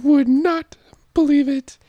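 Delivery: very high falsetto storyteller; high character voice. Acted. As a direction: tell the story in a squeaky falsetto.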